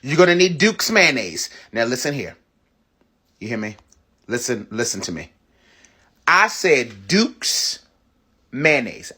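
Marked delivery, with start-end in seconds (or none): 1.7-5.3 low, soft, leaning in close; hushed warning. Real, not acted. To viewers about which mayonnaise to buy, a hushed warning. 6.3-9.1 "Duke's" drawn out very slowly, each word spaced; slow insistence. Real, not acted. Insisting to viewers on the brand, slow and deliberate.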